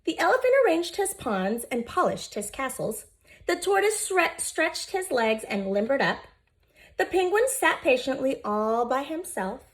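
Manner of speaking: usual speaking voice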